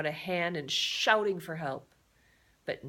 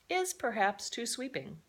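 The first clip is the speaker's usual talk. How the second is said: usual speaking voice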